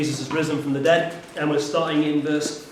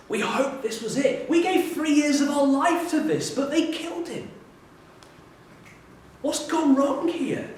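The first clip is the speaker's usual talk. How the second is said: higher, louder despairing lament; crushed by failure; 13 semitones higher, 3 dB louder than usual. Acted. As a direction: pour out their dashed hopes. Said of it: "this crushing weight of failure".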